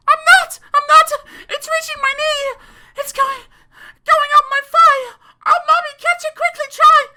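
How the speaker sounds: very high, loud, fast whimpering shrieks; a small child near tears with terror; child crying in panic. Acted. Child panics as scorpion climbs.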